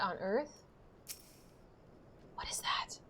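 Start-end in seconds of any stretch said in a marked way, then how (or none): 2.3-3.0 whispered under the breath; whispered wonder. Real, not acted. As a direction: breathe the question in awe. Puzzled at a strange mount.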